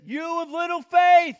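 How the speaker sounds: loud, high shouted rebuke. Acted. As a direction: call him out at full voice. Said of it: "He says".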